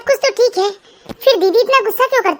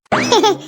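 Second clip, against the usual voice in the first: short laugh. Acted. Mother-in-law laughs after complaining.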